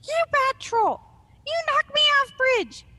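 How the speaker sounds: very high, loud, furious troll tantrum; screaming troll. Acted. Small troll rages at Arg from the stream, screaming. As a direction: scream it in a squeaky troll rage. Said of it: "screaming the whole time".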